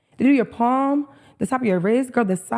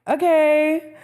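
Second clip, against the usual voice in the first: mock okay; 8 semitones higher, 3 dB louder than usual. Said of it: "So I'm like".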